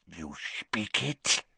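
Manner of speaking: low slithering serpent tongue; Parseltongue. Acted. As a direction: hiss the question like a serpent. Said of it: "Riddle spoke in Parseltongue".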